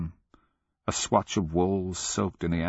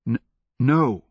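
stammered no, higher and louder; hitching plea; 5 semitones higher, 5 dB louder than usual. A narrator's reading. Wretched boy pleading in the dark, hitching. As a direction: catch on the first sound, then cry no. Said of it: "he heard a hitching, glutinous voice murmur".

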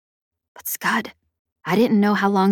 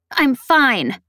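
higher, tense insistence; through gritted teeth; 6 semitones higher, 3 dB louder than usual. A narrator's reading. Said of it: "I said through gritted teeth".

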